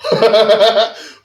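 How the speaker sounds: loud high laugh breaking out; burst of laughter. Real, not acted. Host bursting out laughing at the guest's answer.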